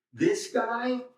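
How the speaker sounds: "This guy" pitched very high for emphasis; single out "This guy" on a high, held pitch; high-pitched, pointed emphasis on "This guy"; emphatic. Acted. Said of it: "is in emphatic position".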